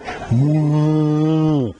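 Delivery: high drawn-out laugh falling away; imitated man's laugh. Preacher mimics a man he knows, drawn-out falling laugh. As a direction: ham up a man's odd laugh. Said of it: "I know a man that laughs like this".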